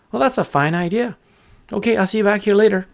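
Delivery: high, quick bird farewell; high-pitched chirpy bird; agreeable little bird. Acted. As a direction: agree brightly as a small bird.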